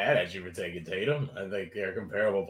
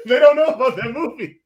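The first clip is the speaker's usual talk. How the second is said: laughing through the line; cracking up while saying it; 9 dB louder than usual. Real, not acted. Laughing repeat of a joke.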